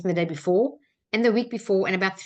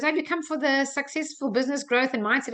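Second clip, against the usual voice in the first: put-on higher-pitched German-accented question; 6 semitones higher than usual. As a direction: imitate the German woman at the front. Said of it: "lady at the front with the German accent".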